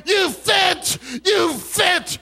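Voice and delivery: high, shouted sermon delivery; shouted revival preaching, pitched high; full-force preaching. Real, not acted. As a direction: preach it loud to the whole congregation.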